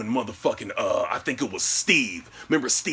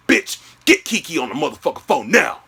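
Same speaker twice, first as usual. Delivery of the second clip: loud, high, furious demand; angry order; 4 semitones higher, 6 dB louder than usual. Retold or imitated. Boyfriend ordering girlfriend to call friend, angry order. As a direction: bellow the command, acted fury.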